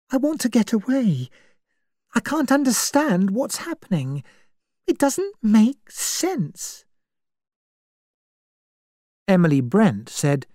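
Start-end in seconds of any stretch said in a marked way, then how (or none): 0.1-6.8 put-on higher, anxious young woman; a scared girl wanting to escape; frightened young woman. Vera pleads with Emily, higher and anxious.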